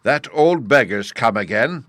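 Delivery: loud, slow excited shout. A narrator's reading. Boy bursting into room, loud shout. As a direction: burst in yelling the news.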